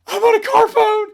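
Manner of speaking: put-on shrill cracking kid voice shouting the news; thrilled kid squeal. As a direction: squeak it out like a kid bursting with pride. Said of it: "my voice even cracked as it went higher".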